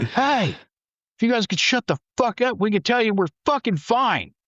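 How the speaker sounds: high-pitched retold yell into the radio; mock radio yell. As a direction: shout over everyone on the net. Retold yelling over the radio net.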